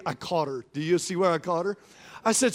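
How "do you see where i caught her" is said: usual speaking voice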